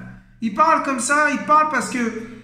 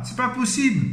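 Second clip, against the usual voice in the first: usual speaking voice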